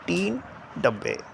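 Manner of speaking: टीन held up, then डब्बे dropping down; falling tone on डब्बे. Shown as a demonstration. Teacher demonstrating the fall to students, टीन level and डब्बे falling. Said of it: "नीचे गिरा".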